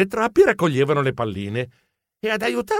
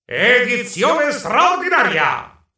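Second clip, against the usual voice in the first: slow, high newsboy proclamation; news announcer; 3 semitones higher than usual. Acted. Announcing champion's exclusive special edition, newscaster proclamation. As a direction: proclaim the headline, stretching every word.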